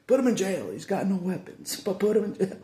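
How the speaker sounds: loud, high indignant outcry; outraged protest. Retold or imitated. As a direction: shout it like you can't believe the injustice. Outraged retelling of arrest.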